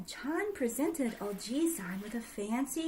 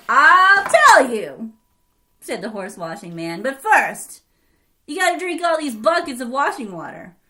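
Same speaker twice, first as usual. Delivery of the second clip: horse-washing man voice; 11 dB louder than usual. Acted. Horse-washing man sets a task.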